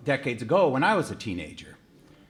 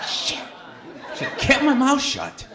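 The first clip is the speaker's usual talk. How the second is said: high, laughing self-rebuke; rueful laugh; 13 semitones higher, 3 dB louder than usual. Retold or imitated. Father scolding himself after the officer's logic, laughing. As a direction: laugh at your own mistake.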